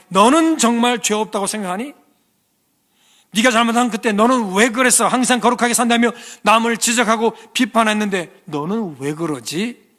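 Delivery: loud, high searching questions; Jesus's words piercing their hearts; probing rebuke. Acted. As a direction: press the conscience with every question. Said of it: "질문을 던지던".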